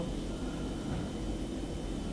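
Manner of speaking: soft breathy yawning sigh. Shown as a demonstration. Therapist showing students a gentle yawn sigh. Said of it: "so just begin by yawning".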